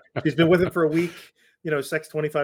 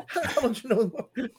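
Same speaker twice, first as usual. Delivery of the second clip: laughing quote; 8 semitones higher than usual. Retold or imitated. Kid mocking in retold scene.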